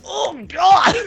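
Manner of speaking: high, loud yelp of pain; a yanked rider crying out in sudden pain. Acted. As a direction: yelp as the cable jerks you. Player character yanked by cable.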